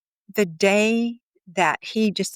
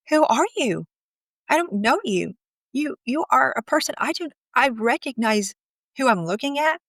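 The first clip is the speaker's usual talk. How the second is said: higher, disbelieving retold plea; baffled, searching, pitched up; bewildered; 6 semitones higher than usual. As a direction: question him as if he were a stranger. Retold bewildered confrontation of husband.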